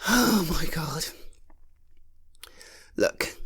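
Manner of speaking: ordinary delivery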